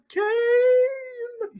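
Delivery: high, slow, drawn-out KANE; hyped announcement. Pretend. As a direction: stretch the name out loud like a ring announcer. Mock announcing the wrestler's entrance.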